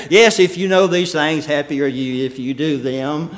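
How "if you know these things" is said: normal talking voice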